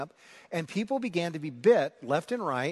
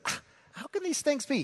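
pitched well up, a puzzled cry of disbelief; baffled Nicodemus; 11 semitones higher than usual. Retold or imitated. Nicodemus to Jesus, a lightly voiced frustrated question. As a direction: play the confused Pharisee. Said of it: "So he's frustrated".

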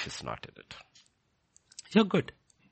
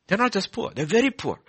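1 louder emphatic repeat; 11 semitones higher, 6 dB louder than usual. Shown as a demonstration. Preacher repeats line, then louder.

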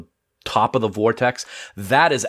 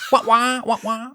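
high, whining wah-wah door noise; whiny door sound; 12 semitones higher than usual. Retold or imitated. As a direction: imitate a whining sliding door. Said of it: "You sounded like a whiny shuttlecraft door".

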